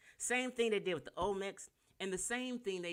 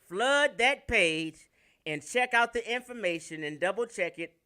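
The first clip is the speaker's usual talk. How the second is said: rally the crowd to act; fired-up call to action; loud rallying call; 4 semitones higher, 10 dB louder than usual. Real, not acted. Urging viewers to flood page.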